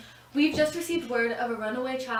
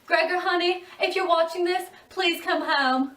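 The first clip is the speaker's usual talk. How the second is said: tearful plea; 7 semitones higher, 6 dB louder than usual. Acted. Mother pleading to son.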